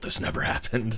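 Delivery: conspiratorial stage whisper; hushed aside. Retold or imitated. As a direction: whisper it as a secret warning. Dipper's hushed aside to Mabel.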